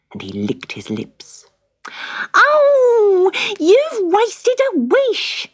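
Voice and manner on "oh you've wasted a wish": high, loud wailing cry of outrage; furious shout. Acted. Wife Magda screaming at Cedric over the wasted wish. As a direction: scream it at him in despair. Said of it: "Magda shouted".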